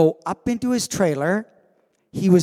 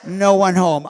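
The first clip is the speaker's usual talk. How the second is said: blank stare; 2 semitones higher, 6 dB louder, slower than usual. Retold or imitated. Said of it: "It was like".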